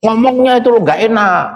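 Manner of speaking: sharp scolding "nggak enak"; harsh complaint. Shown as a demonstration. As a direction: snap it like an impatient mother. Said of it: "Ya, turunkan sedikit".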